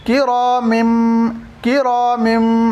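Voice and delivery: किरामिम slowly, the final m hummed; slow careful recitation; nasal m ending. Shown as a demonstration. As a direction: hold the m of किरामिम in the nose. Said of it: "तो होगा ये".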